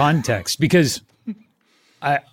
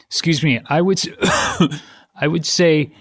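coughing mid-sentence. Pretend. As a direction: cough breaks the name. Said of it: "Choking Every Other Word".

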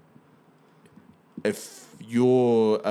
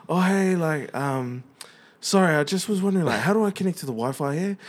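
much higher, apologetic and hesitant; timid New Zealand asker; 8 semitones higher than usual. Retold or imitated. Imitating his own timid self at the airport Wi-Fi, high and apologetic. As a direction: ask sheepishly, sorry for bothering. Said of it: "I asked it in a New Zealand way".

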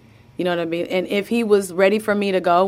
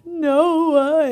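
high, louder, drawn-out name in wonder; delighted child; 10 semitones higher, 4 dB louder than usual. Retold or imitated. Mother imitating her son seeing the baby, delighted. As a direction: say it like a thrilled kid meeting his baby brother. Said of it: "he looked at him and he's like".

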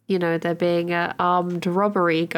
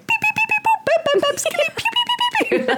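high, playful beeps and blaster sounds; control panel noises; much faster than usual. Pretend. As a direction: beep and pew like a spaceship console. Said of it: "just being like".

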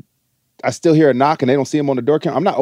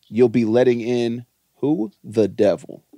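low, slow ominous reveal; hushed creepy legend telling; 4 semitones lower, slower than usual. Acted. Spooky reveal of the devil.

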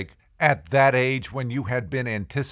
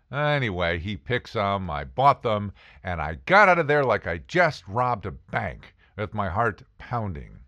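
normal talking voice

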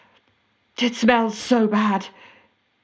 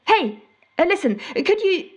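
higher, brightened call to someone who just appeared; 7 semitones higher than usual. Acted. Caller spots cocoa-loving housemate and calls to him eagerly. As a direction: perk up and call him over mid-sentence.

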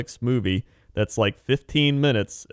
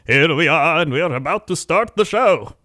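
higher, louder hype; booming kids show opener; 6 semitones higher, 5 dB louder than usual. Acted. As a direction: announce the show start big. Hyped show start.

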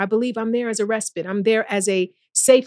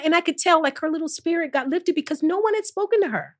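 ordinary talk

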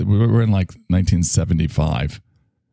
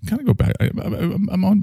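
high squeaky aside; 12 semitones higher than usual. Acted. Sudden high-pitched aside.

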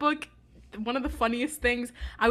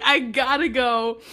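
slow, loud exit line; deliberate mock exit; 5 dB louder, slower than usual. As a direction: draw it out firmly, imitating a character leaving. Retelling Comac's exit line.

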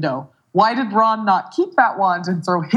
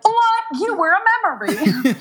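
shocked gasp; 11 semitones higher than usual. Retold or imitated. Harry shocked at Tom.

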